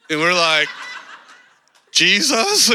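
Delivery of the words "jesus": laughing question. Retold or imitated. Timid answer to the preacher.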